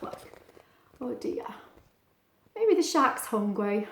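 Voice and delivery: ordinary delivery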